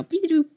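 high-pitched power-down chirp; playful mock gadget noise; imitated electronic beep. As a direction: mimic an appliance's little beep-boop. Imitates appliances losing power.

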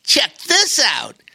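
a tiny spider introducing himself; friendly spider voice. Acted. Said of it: "said Darwin".

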